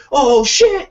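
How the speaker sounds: high, loud amazed gasp; amazed exclamation. Retold or imitated. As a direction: blurt it in awe. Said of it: "and you're like".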